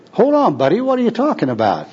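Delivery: high-pitched, incredulous objection; indignant protest. Acted. Tramp interrupts the street preacher, indignantly. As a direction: cut in, baffled and protesting.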